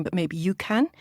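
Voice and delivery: usual speaking voice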